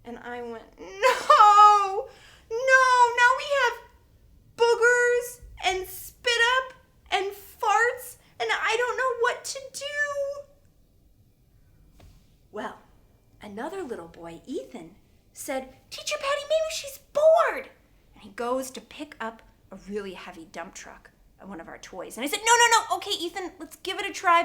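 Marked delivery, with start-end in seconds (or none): 0.8-10.4 much higher and louder, frantic; panicked cry. Retold or imitated. Teacher panics at boogers. 15.9-17.7 put-on little boy voice. Little boy Ethan suggests. 22.4-24.4 flustered no. Retold or imitated. Teacher stopping Ethan laughing.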